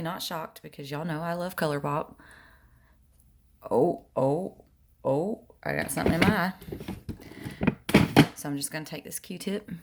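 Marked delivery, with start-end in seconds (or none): 3.7-6.5 startled. Real, not acted. Something suddenly gets in eye.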